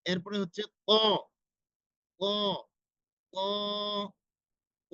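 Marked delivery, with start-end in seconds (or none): 2.2-4.9 ক said heavy and full, the middle of the tongue touching the palate; heavy qa sound. Shown as a demonstration. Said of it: "এটা উচ্চারণ হবে মোটা". (মোটা করে ক উচ্চারণ)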